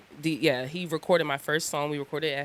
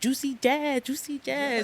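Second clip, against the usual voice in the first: higher, slurred imitation of a drunk friend; Frank's voice; 8 semitones higher, slower than usual. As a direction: imitate how Frank mangles the nickname. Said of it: "That's how he talk".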